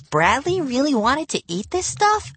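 put-on high disbelieving girl; incredulous. A narrator's reading. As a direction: ask in disbelief. Incredulous sister.

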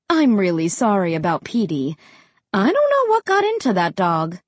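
apologise, slightly baffled; apologetic. A narrator's reading. Mom to the realtor about the dog, apologetic. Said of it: "Mom said apologetically".

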